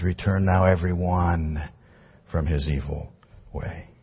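a soft, hushed repeat of the verse; quiet solemn echo of scripture; softly repeated text. Acted. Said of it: "repeating this message".

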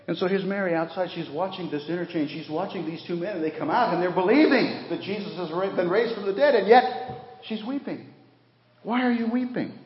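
high, loud building sermon delivery; preacher climbing in pitch and force; preaching at full force. Acted. Preacher to congregation, rising fervent preaching.

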